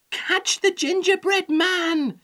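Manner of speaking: high-pitched urgent shout. A narrator's reading. Old woman to the old man, shouting high. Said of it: "shouted the old woman to the old man".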